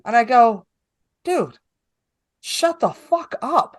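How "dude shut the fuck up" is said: much higher, slowed-down retold telling-off; exasperated rebuke. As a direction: stretch it out in exasperated disbelief. Retells silencing the panicking comic.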